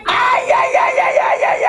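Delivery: high yelping aïe aïe; killer yell. Acted. Clown doing the cri qui tue to scare the kid, high yelp. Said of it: "à trois, tu sautes très haut".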